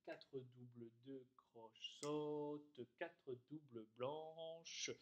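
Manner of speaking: rhythm names spoken slowly in time. Shown as a demonstration. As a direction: drag each note name to its length. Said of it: "Vous le faites plusieurs fois".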